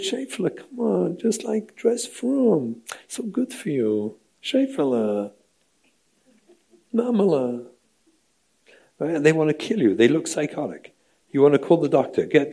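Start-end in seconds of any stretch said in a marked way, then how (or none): 0.0-7.7 much higher, sickly sweet coaxing; sugary nice voice. Retold or imitated. Parent sweetly nagging daughter about modest dress, much higher sugary voice. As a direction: coax her like a falsely kind parent. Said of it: "I said it so nicely".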